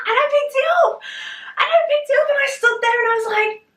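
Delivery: high, loud, breakneck storytelling; excited. Real, not acted. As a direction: race excitedly through the climax. Excited climax of lifting story.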